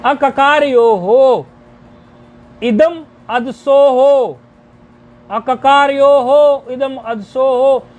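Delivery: slow recitation, each word set apart; stretched, careful word-by-word reading; deliberately broken up. Shown as a demonstration. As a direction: break it up so students can follow. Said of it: "तोड़ के बोल रहे हैं जानबूझ के ताकि समझ पाओ".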